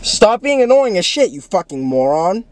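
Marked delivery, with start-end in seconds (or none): 0.0-1.3 high exasperated yell; shouting. Real, not acted. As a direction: yell at him to stop. Yelling at annoying friend.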